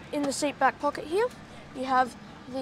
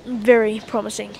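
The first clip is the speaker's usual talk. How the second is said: sarcastic; 2 semitones lower, 8 dB louder than usual. Real, not acted. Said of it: "That, that was sarcasm".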